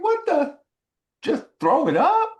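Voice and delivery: high, loud, indignant question; retold incredulous outburst; upset disbelief. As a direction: throw the instruction back in outrage, rising sharply. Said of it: "I was so upset".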